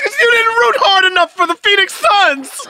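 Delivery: very high, loud accusing wail; hammed-up tantrum shout; upset kid's yell. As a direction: imitate a child screaming blame. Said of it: "He says".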